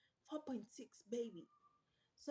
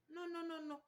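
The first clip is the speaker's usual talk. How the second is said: usual speaking voice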